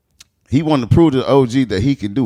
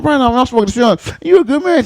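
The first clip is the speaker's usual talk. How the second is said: much higher, buttering him up; overfriendly; 12 semitones higher, 4 dB louder than usual. Retold or imitated. Chatting up the robber, high and overfriendly. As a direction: sweet-talk him. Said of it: "I'm like".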